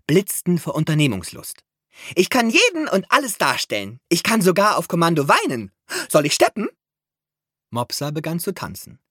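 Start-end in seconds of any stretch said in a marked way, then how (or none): 2.1-6.7 higher, louder show-off voice; übermütig prahlend, höher und lauter; eager boasting. A narrator's reading. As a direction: brag with bursting eagerness. Eager actress mouse boasts.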